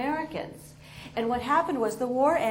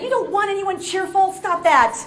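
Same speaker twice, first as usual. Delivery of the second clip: mock snap; 8 semitones higher, 8 dB louder than usual. Grumpy outburst at cheerful people.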